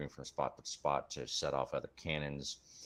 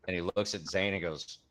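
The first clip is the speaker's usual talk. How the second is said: ordinary delivery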